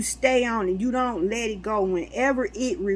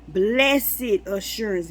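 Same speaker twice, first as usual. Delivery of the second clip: preaching; 3 dB louder, slower than usual. Acted. Preaching a revelation.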